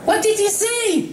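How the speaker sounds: very high, eager pony voice; Pinkie, breathless curiosity; Pinkie Pie. Acted. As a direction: lean in, dying to know.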